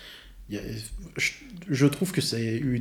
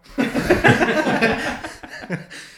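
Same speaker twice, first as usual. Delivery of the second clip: big laugh bursting out; loud burst of laughter; 14 semitones higher, 10 dB louder than usual. Real, not acted. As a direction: laugh hard and high. Éclat de rire après putain. (grand éclat de rire)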